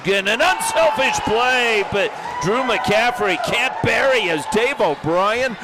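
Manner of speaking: excited shout. Acted. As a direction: belt out the big save like a commentator. Big save call in hockey.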